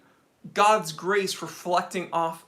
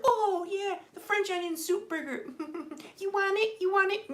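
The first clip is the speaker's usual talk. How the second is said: much higher, softer taunting voice with a laugh; teasing restaurant; 11 semitones higher, 6 dB quieter than usual. Acted. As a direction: dangle it in front of him, high and mocking. Voicing the restaurant teasing him.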